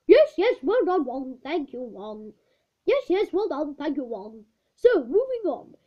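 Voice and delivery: brisk, a touch of impatience; impatient teacher. Acted. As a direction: hurry him along, politely. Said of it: "becoming irritated".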